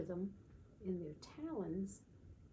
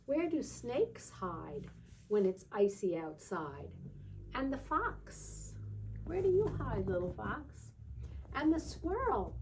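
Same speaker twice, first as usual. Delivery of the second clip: higher, lilting read-aloud questions, slow in places; playful higher sing-song book reading; storybook questions; 5 semitones higher, 5 dB louder than usual. Acted. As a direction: ask each animal like a curious storyteller to a toddler. Reads picture book to child.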